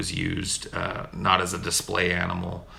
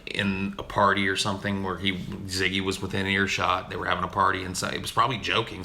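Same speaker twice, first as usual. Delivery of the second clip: ordinary delivery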